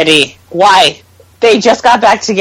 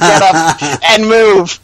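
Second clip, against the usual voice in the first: slow, drawn-out order through laughter; laughing order; 2 semitones lower, much slower than usual. Retold or imitated. Ordering Fred out of the seat, laughing slowly. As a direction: laugh while ordering him to move. Repeating own imagined order.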